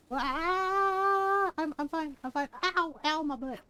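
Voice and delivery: yelping after a sudden fall; startled high yelp, then sore; tripping and falling. Real, not acted. Character falls in game.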